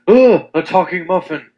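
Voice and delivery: loud, high gasp of shock; shocked muffin. Acted. Muffin delivering the punchline to another muffin, shocked gasp. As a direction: act out a startled muffin, taken aback. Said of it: "completely shocked and kind of taken aback".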